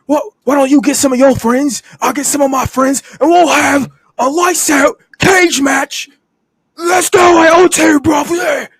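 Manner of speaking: high-pitched, hyped-up mock wrestler, building to a shout; Ospreay. Wrestler pitches a cage match to his rescued partner, mock hype. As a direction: pitch a big idea excitedly, then yell it. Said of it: "and he's like".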